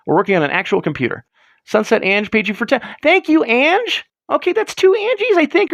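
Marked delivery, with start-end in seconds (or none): 3.0-5.7 silly high voice. Real, not acted. Thanking donor in silly voice.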